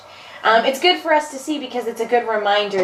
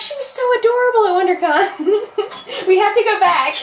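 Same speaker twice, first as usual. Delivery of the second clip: laughing; 11 semitones higher than usual. Real, not acted. Gushing over a guest.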